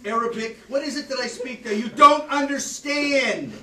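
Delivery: high, loud, sarcastic telling-off; furious teacher lecturing an inattentive class; angry scolding. Real, not acted.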